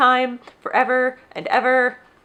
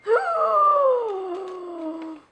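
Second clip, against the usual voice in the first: high breathy gasp of delight; sharp excited intake of breath; 11 semitones higher than usual. Real, not acted. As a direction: gasp like you just saw something adorable. Unboxing tiny books, overjoyed.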